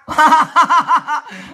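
high, pulsing burst of laughter; real laugh. Real, not acted. Woman amused by officers sending her away, real high laughter.

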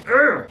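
high exasperated groan; disgusted "ugh". Real, not acted. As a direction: groan in disgust at a bad opinion. Disgusted reaction to the book.

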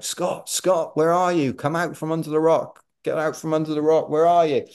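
high-pitched searching call; witch calling out. Retold or imitated. Runner voices the witch hunting for him under a rock, calling out. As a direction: imitate someone calling for a lost person, high and insistent. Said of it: "the witch calling out".

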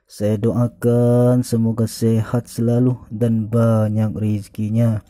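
usual speaking voice

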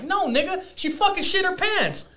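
high-pitched indignant exclamation; retold exasperated retort. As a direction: protest it way up high. Retells exasperated retort to homegirl.

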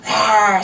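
loud screeched yell; toddler screech. A narrator's reading. Little brother tugging at the toy, loud screech. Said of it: "screeched Daniel".

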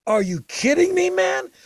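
can't believe the band's luck; thrilled disbelief. Retold or imitated. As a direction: pitch climbing high and loud on man.

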